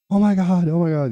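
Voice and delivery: high-pitched wail imitating a frantic mom; panic, voice way up, as a retold outburst; flipping out. Mom hearing twelve weeks, flipping out. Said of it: "flips out".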